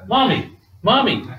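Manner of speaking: mock childish cry, repeated; three-year-old crying for mommy. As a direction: imitate a toddler nagging for mother. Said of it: "a three-year-old who cries".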